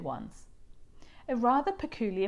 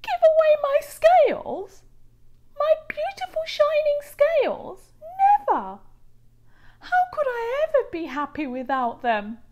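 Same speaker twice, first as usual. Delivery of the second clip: much higher, louder outraged voice; horrified refusal; 10 dB louder than usual. A narrator's reading. Rainbow fish alone, refusing to give scales away. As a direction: recoil at the very idea.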